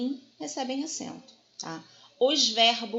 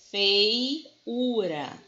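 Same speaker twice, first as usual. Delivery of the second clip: "feiura" drawn out, split as fei-ura; separado em sílabas; 4 dB louder than usual. Shown as a demonstration. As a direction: stretch "feiura", parting the diphthong "fei" from the "u". Repete a palavra separando ditongo e hiato. ("feiura" esticado, separando fei e ura)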